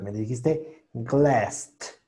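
slow "glassed" with a marked final T; imitating error. Teacher mimics the student's glassed with a T. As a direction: drag out "glassed", stressing the added T. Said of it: "me marcaste una T al final".

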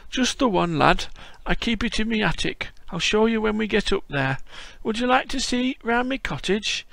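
put-on old Yorkshire grandad greeting a visitor; frail old Yorkshireman. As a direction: play a creaky old Yorkshire lad-caller. Voicing old Yorkshire Bob.

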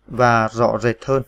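slow, careful reading of the words; slow dictation. Shown as a demonstration. Teacher dictating the next words slowly. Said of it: "T, dấu nặng phím G".

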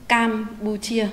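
"Campuchia" with a clear "ch" initial on "chia"; teacher demo of "Campuchia"; model pronunciation. Shown as a demonstration. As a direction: say "Campuchia" slowly, stressing the "ch" sound. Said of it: "chú ý phát âm đúng âm đầu chờ".

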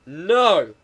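loud drawn-out NO; mock outburst. Player arguing with scientists, mock indignant shout. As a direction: burst out with a long, loud no.

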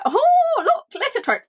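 high, loud mock delight; hammed-up cat's discovery; imitating the cat. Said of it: "She's like".

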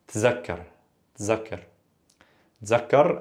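"tzakkar" drilled with a clear z, pausing between; dialect z sound. Shown as a demonstration. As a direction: repeat "tzakkar" Levantine style, z not th. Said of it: "with a z sound".

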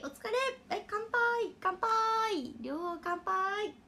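high, bright cheers; cheerful toast. Real, not acted. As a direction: raise a glass and cheer. Toasting viewers with drink.

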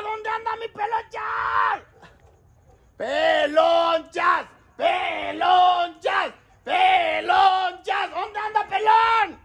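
high, slow drawn-out shouts; calling out. Real, not acted. Calling a friend from the street, drawn-out shouts. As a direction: stretch each Pelonchas out.

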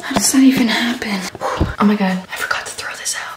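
hushed whisper, keeping quiet at night. Real, not acted. Vlogger whispering to camera late at night, hushed. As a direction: whisper so mom won't hear.

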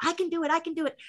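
high, timid little voice. Retold or imitated. Terrified star tells the director he can do it, high and timid. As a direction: nervously reassure yourself in a high voice. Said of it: "he was terrified".

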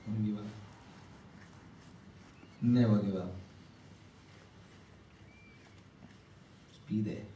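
low, soft, with long pauses; hushed exhortation. Real, not acted. Preacher telling friends never to give up, hushed and earnest. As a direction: urge them quietly and let each phrase settle.

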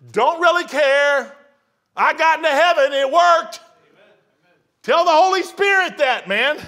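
loud, high fiery preaching. Acted. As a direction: hammer the crowd. Preaching at full force.

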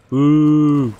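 loud playful boo. Real, not acted. Booing again louder.

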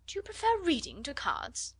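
high-pitched astonished question; incredulous, pitch leaping up. A narrator's reading. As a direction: ask it in sheer disbelief. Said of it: "looked at her with astonishment".

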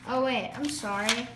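ordinary talk

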